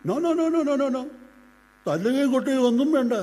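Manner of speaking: high, rapid-fire refusal; hasty forbidding. Acted. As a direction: cut the idea off at once. Hurriedly forbids any beating.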